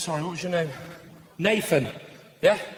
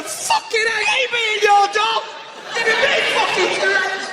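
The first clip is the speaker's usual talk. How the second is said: high, shouted dog's complaint; mock outraged high-pitched voice; exasperated dog; 13 semitones higher, 3 dB louder than usual. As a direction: yell as a fed-up talking dog.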